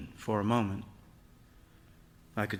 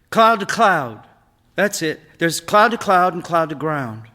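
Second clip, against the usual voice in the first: put-on young boy, higher and louder, eager to explain; boy's voice, pitched up, lecturing proudly; boy Eric explaining; 7 semitones higher, 11 dB louder than usual. A narrator's reading. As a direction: play the son showing what he learned. Eric explains lightning types.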